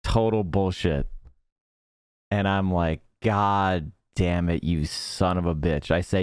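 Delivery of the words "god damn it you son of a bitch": slow, low cursing, anger held in; retold bitter cursing. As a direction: curse him slowly, low and understated.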